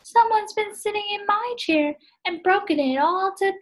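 high little bear voice, upset; tiny cub whining about his empty porridge bowl; Baby Bear. Acted. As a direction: squeak it out like the smallest bear cub. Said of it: "Baby Bear said".

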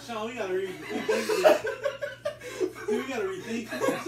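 laughing loudly through the burn; half laugh, half panic. Real, not acted. Laughing after hot pepper.

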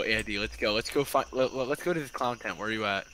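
normal talking voice

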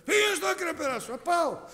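loud, high, fast shouted exchange; angry driver. Retold or imitated. Preacher acts out drivers quarrelling in the street, fast angry shout. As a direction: bark it like a furious driver in traffic.

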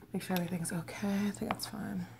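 unvoiced whisper to herself. Real, not acted. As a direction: whisper it under your breath. Checking mic while eating.

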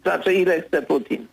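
ordinary talk, nothing marked

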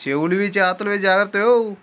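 high, loud warning cry from the back; lightly voiced old woman yelling; old woman shouting. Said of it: "ముసలమ్మ అరుపులు".

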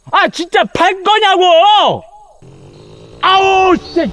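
exasperated shout. Acted. Pressing for an answer, exasperated.

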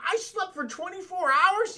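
high, loud disbelieving question; mock shocked Peter. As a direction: act stunned at sleeping a full day.

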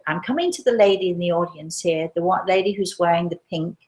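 ordinary talk, nothing marked

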